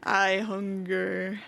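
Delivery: very slow, distorted demon voice; voice-modded demon, dragged out; demonic voice. Retold or imitated. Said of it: "I had to turn off the voice mod".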